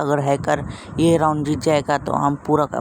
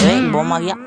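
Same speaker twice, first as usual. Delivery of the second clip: louder sudden alarm; startled shout; 2 semitones higher, 7 dB louder than usual. Real, not acted. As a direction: jolt at the bomb. Bomb lands suddenly. (अचानक चौंककर चिल्लाना)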